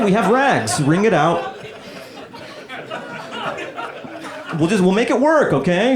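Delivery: dad forcing calm cheer; frantic dad. Acted.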